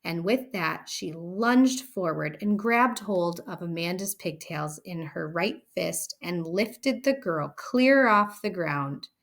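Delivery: plain reading voice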